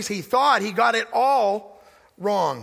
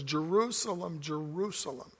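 much softer, fading, grieving lament; weeping over Jerusalem; 4 semitones lower, 11 dB quieter than usual. Retold or imitated. Preacher voicing Jesus weeping over the city, hushed lament. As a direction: mourn the city's name as it fades. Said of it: "he's weeping over Jerusalem".

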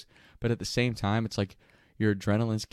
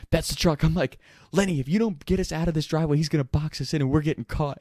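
raised, fast alarmed pleading; panicked warning; 5 semitones higher, 4 dB louder, faster than usual. Retold or imitated. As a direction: warn the driver before you're boxed in.

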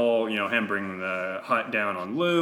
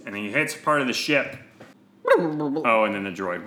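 ordinary talk

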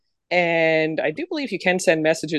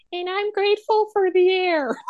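much higher, sugary mock-earnest line; mock sappy voice; 13 semitones higher than usual. Mocking her own weak gratitude answer, in a high sappy voice. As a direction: ham up a sentimental gratitude. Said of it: "I'm just gonna be like".

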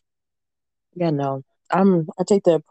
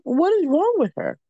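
shocked reaction; 12 semitones higher than usual. Retold or imitated. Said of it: "I was like".

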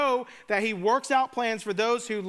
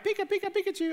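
high squeaky Pikachu call, playful and higher; 10 semitones higher, much faster than usual. Pretend. Preacher's playful aside, squeaky Pikachu call. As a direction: chirp it like the cartoon Pikachu. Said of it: "I think of, like, Pikachu".

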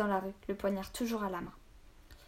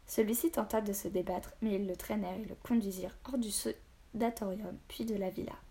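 plain reading voice

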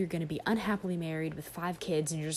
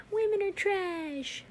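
mocking; 13 semitones higher, slower than usual. Mocking complaining guys.

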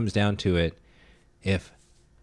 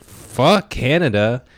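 louder, higher, drawn-out indignation; mock outrage; 4 semitones higher, 6 dB louder, much slower than usual. American reacting to a Canadian invasion, mock outraged. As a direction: stretch it out in playful patriotic fury.

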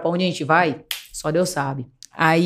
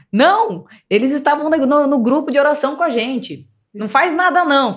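nervous mother; 6 semitones higher than usual. Retold or imitated. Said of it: "minha mãe tão nervosa".

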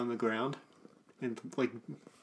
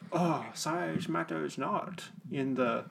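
slow, soft character voice; tentative Yoda impression, slow and soft; 5 dB quieter, much slower than usual. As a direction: draw the words out like an old sage, quieter. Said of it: "Yoda's like".

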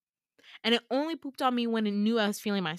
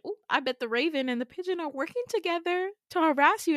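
teasing friends; 7 semitones higher, 5 dB louder than usual. Retold or imitated. Friends teasing him, retold.

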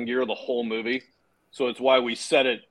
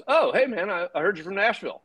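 higher, chatty imitation of a colleague; retold casual hello, pitched up; friendly small talk; 6 semitones higher than usual. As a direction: play a chummy greeting.

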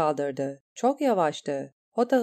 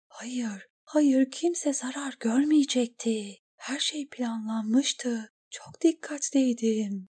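higher, slow, softer trembling grief; broken sobbing voice; 5 semitones higher, 3 dB quieter, slower than usual. A narrator's reading. Eriyen on the sand after the death, weeping. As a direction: whimper it through tears. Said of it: "ağlıyor ve akşam yemeğini kusuyordu".

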